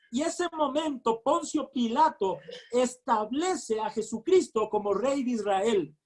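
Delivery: ordinary delivery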